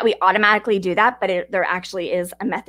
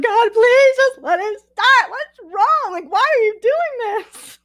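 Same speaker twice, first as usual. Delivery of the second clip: high, loud, drawn-out wail; hammed-up wailing at a broken-down car; mock crying plea; 12 semitones higher, 3 dB louder, slower than usual. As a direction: re-enact a tearful, angry meltdown. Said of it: "so angry and I was crying".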